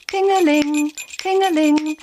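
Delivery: high, ringing ting-a-ling; bell sound. A narrator's reading. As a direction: chime it like a tiny bell.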